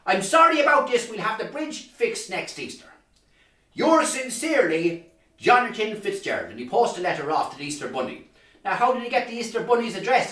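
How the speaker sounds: ordinary delivery, nothing marked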